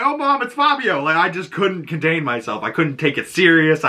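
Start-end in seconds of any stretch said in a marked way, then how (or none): 0.0-1.0 high-pitched, giggly retold outburst; helpless amusement. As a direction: squeal it to mom, barely containing laughter. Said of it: "I was laughing so hard".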